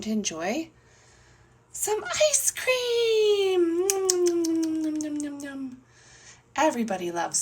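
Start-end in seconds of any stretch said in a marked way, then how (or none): 1.7-3.9 high, loud, very drawn out; gleeful announcement. Acted. Storyteller announces the treat. 3.9-5.8 high hum gliding down and fading; imitated greedy eating; munching "nom nom" noises. Imitates eating ice cream.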